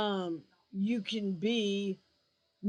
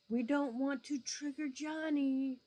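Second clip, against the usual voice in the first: mock babying; 6 semitones higher than usual. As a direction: tiptoe around little Johnny, sarcastic. Mocking coddling of sensitive viewers.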